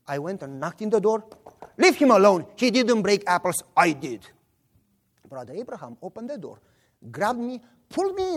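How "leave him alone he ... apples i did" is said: loud confession, dropping in pitch; protesting shout. Retold or imitated. Boy at the door defending his friend, calling out. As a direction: demand it firmly, then own up.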